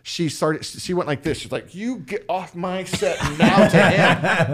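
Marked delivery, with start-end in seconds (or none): 1.7-4.5 slow, high-pitched mock tirade; hammed-up angry order; retold yelling. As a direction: imitate someone ordering an intruder off, word by word. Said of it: "he just started yelling at my friend".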